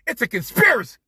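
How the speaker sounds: yelling at the TV in disbelief; outraged yell. Retold or imitated. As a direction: shout it high and loud, furious at the refs. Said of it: "and I'm like".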